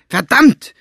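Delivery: sharp high yell; shouting. A narrator's reading. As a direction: shout the curse out. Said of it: "schrie sie Staubfinger an".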